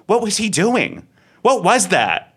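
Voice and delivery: high, loud incredulous questioning; acted bafflement. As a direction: imitate someone utterly baffled, pitch swooping high. Retold baffled contestant quizzing the host.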